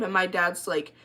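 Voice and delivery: ordinary delivery, nothing marked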